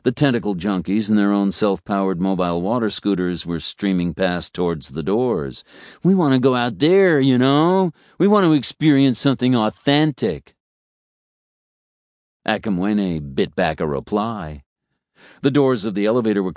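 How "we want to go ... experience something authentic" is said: high, loud, earnest tourist gush; eager roadie. Acted. Roadie insisting to Akamwene on going outside, loud and eager. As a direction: push it like a keen roadie craving the real thing.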